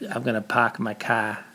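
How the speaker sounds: slow mock Boston accent with no R's. Telling chat how Bostonians talk, mock Boston accent. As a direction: imitate a Bostonian parking his car. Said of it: "a funny accent where they don't really say R's".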